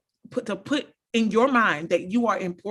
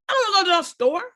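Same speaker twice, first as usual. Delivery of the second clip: with an attitude; 7 semitones higher, much faster than usual. Retold or imitated. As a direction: toss it off like an annoyed wife. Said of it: "with an attitude".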